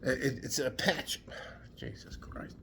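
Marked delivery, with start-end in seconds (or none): 1.8-2.6 exasperated whisper. Real, not acted. Frustrated at his memory.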